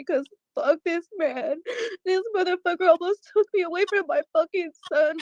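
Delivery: high, tearful, defiant outburst; furious through tears; crying and angry. Real, not acted.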